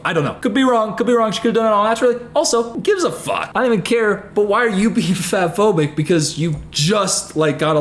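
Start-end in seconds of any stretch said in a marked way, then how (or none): none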